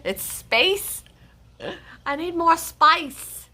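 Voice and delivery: badly faked Australian accent, drawn out; mock Australian accent. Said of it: "I have an accent".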